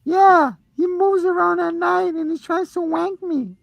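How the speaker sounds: put-on high, excited little kid voice; squeaky child insisting; little boy voice. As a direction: play a small boy tattling to mom.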